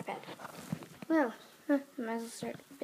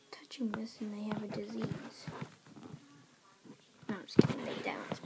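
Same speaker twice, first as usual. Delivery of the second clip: whispering; 3 semitones lower than usual. Real, not acted. Whispered gossip about disease.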